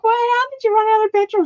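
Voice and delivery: high, laughing disbelief; incredulous squeal of wait, how; shocked disbelief. Retold or imitated. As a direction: react as if you can't believe it, half laughing. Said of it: "I'm like".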